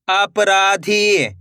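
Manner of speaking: अपराधी very slow, syllables stretched; blended syllable reading. Shown as a demonstration. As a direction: draw out अपराधी for learners. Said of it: "एक अक्षर का उच्चारण सही से". (अपराधी को धीरे से खींचकर)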